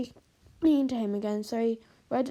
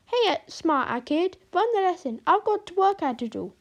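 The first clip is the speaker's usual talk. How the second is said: Italian teacher voice; 7 semitones higher, 5 dB louder than usual. Acted. Teacher voiced leaving room.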